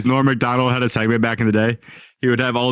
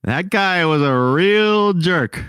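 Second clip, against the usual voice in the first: higher, slow deadpan closer; Norm Macdonald impression; 7 semitones higher, much slower than usual. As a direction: drag out the punchline, dry. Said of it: "he would go".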